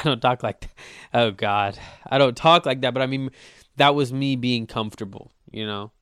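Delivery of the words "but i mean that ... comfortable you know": ordinary delivery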